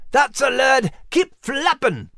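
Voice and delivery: high, drawn-out rallying cry; encouraging. A narrator's reading. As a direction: egg the youngster on, pitched high. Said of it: "encouraged Max".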